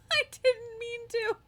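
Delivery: high, laughing through the words; giggling while talking. Real, not acted. Laughing at her own mistake.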